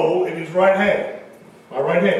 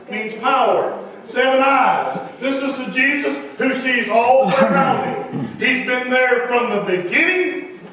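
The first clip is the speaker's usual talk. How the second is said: louder, emphatic preaching with slowed key words; 5 semitones higher, 4 dB louder than usual. Acted. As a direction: drive each word home from the pulpit. Preaching at full force.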